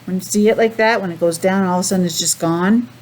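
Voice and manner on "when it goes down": ordinary delivery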